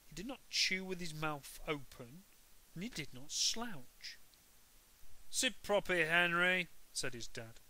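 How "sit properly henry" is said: higher, louder scolding; dad correcting Henry at dinner; stern dad. A narrator's reading. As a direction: tell him off sharply.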